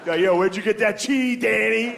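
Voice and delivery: high-pitched call across the table. Acted. Diner spots Danny's sneaked grilled cheese, calling out high.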